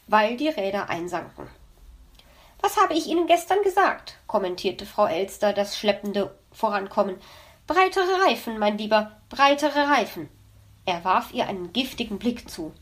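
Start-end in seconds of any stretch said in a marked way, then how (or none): 2.6-4.2 smug magpie voice. Acted. Magpie mocks slow sled. 7.7-10.3 lightly voiced smug magpie. Magpie smugly repeats advice.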